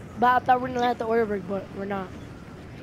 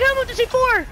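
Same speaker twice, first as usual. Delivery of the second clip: high, loud yell at a teammate; excited shout; 10 semitones higher, 5 dB louder than usual. Real, not acted. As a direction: shout the order mid-fight. Gamer yelling at teammate in fight.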